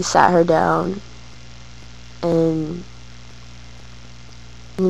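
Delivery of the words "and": usual speaking voice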